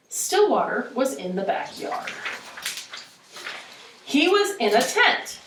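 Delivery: animated read-aloud with wide pitch swings; lively storybook narration with acted dialogue; expressive story reading. Shown as a demonstration. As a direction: read it to a class, exaggerating the intonation. Reads the story with modelled intonation.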